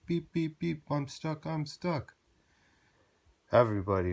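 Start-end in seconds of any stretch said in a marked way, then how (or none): 0.1-2.2 high, urgent beeping cries; high little-truck distress calls; stuck and calling. A narrator's reading. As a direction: sound stuck and a little panicked. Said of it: "cried Little Blue Truck".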